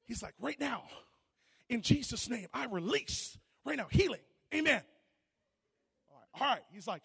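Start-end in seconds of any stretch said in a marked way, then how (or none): 0.4-4.8 loud, high, forceful prayer over her; a boy shouting a bold healing prayer; commanding healing prayer. Retold or imitated. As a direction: declare healing with full force. Kid loudly commands healing.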